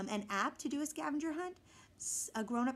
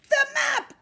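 much higher, very loud cry; panicked cry; 12 dB louder than usual. A narrator's reading. Pirate captain crying out for the map. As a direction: cry out in alarm. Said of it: "cried Braidbeard".

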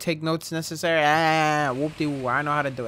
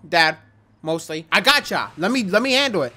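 ordinary talk, nothing marked

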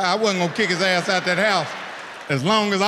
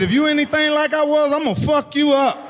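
mock threat; 8 semitones higher than usual. Dad threatening his daughter about boys, high mock warning. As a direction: warn her like a furious dad.

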